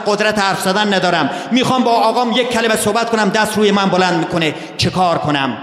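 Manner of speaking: a hint of a worried asker pleading for help; pleading complainer. Preacher voicing a congregant's family troubles, mildly pleading.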